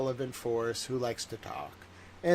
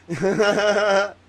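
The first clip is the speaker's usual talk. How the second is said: loud gloating laugh; 7 semitones higher, 11 dB louder than usual. Retold or imitated. Laughing at rivals.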